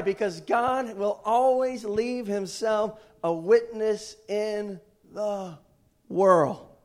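loud, high, rousing preacher delivery; full-force preaching. Real, not acted. Preacher assuring the church we know who wins, full-force preaching. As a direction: build loudly to each key word, drawing it out.

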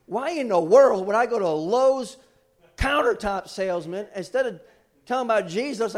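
loud stunned protest at his own past self; incredulous outburst. Real, not acted. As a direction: rant at yourself in loud amazement.

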